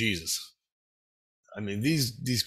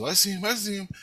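higher-pitched, eager imitation of a companion; retold excited reply; 8 semitones higher, faster than usual. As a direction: act out an excited acknowledgement.

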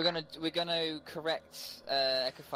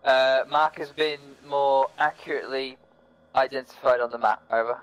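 calm radio call for fire; 11 dB louder than usual. Real, not acted. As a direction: spell it out over the radio.

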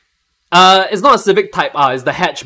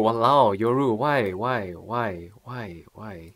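low, very soft, fading plea; mock despair; 6 semitones lower, 10 dB quieter, slower than usual. Streamer asking Yoru and viewers why they did this, quiet mock despair. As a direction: sink into a defeated, drawn-out murmur.

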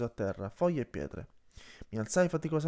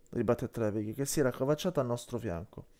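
ordinary delivery, nothing marked

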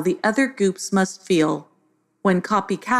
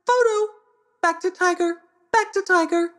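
high squawking bird voice; high, birdlike parrot squawk; 13 semitones higher than usual. A narrator's reading. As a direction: squawk it like a mimicking macaw. Macaws squawking in chorus.

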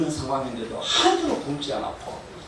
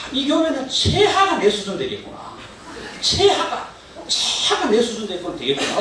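amazed laughing thought; 10 semitones higher than usual. Retold or imitated. Retold amazed inner thought.

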